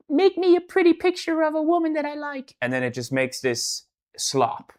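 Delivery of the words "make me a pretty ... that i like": much higher childish mock voice; five-year-old kid. Streamer mocks a kid typing an AI prompt, high childish voice. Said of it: "some five-year-old kid just typed in".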